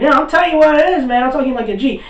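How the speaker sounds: high, loud, rapid mock outburst; defiant retort. Gojo talks back to the old man, defiant. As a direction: fire back high and loud, imitating him.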